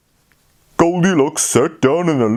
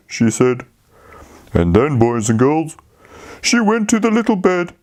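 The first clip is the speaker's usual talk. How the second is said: plain reading voice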